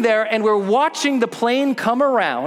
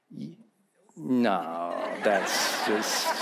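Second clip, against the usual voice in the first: scared mumble; 11 semitones lower, 9 dB quieter, much slower than usual. Retold or imitated. Kid scared looking up tree.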